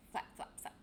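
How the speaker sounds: breathy whispered flap flap flap; whispered flapping sound. A narrator's reading. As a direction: whisper the wingbeats softly. Story sound effect of wings.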